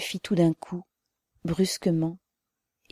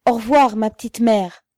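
loud, raised goodbye shout; farewell call; 5 semitones higher, 9 dB louder than usual. A narrator's reading. Arrested comrade calls to the mother, loud farewell. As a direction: call goodbye across a crowd. Calling farewell across the crowd.

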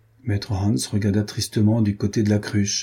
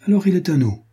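triumphant; 9 semitones higher, 4 dB louder, faster than usual. A narrator's reading. Triumphant decision.

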